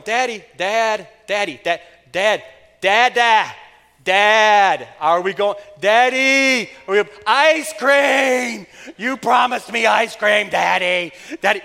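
put-on loud, whining child yelling Daddy over and over; whiny shouting child. A child nags Daddy about the beach and ice cream, loud whiny yelling.

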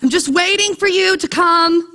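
plaintive, acted-out grumble; complaining. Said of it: "he goes back to complaining".

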